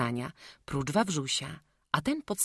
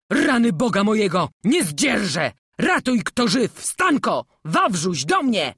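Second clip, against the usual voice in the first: put-on loud, despairing old master calling for help; angry and anguished cry; 6 semitones higher, 10 dB louder than usual. Old master crying out from behind the curtain, angry and anguished. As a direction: bellow in wrath and grief. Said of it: "głos jakiś gniewny i żałośliwy".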